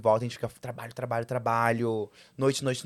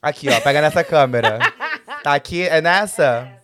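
calling out; 10 dB louder than usual. Real, not acted. Calling grandma through the camera.

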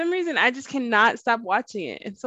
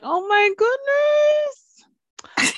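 high, slow, drawn-out squeal of delight; gushing squeal; 3 dB louder, much slower than usual. Retold or imitated. Friend retelling her TV reaction, a high drawn-out delighted squeal. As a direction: stretch out the goodness like you can't handle the cuteness.

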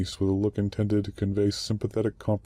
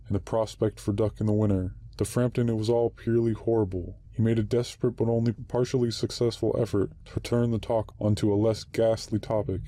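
ordinary delivery

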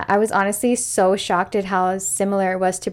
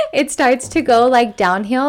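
laughing; 5 semitones higher, 6 dB louder than usual. Real, not acted. Laughing after friend's chewing.

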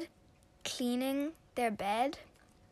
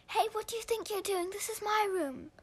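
higher and fast, outraged; indignant; 9 semitones higher than usual. Acted. Sister catching her brother in her room, indignant. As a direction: demand an answer, outraged.

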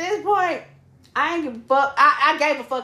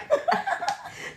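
breathy high laugh; 6 dB quieter than usual. Real, not acted. Laughing at the memory.

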